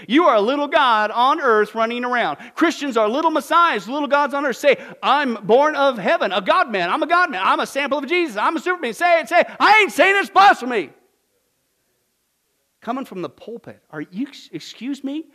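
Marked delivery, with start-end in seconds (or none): 0.1-10.9 loud, high indignant preaching; fiery preaching. Acted. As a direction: thunder it from the pulpit. Preaching at full force.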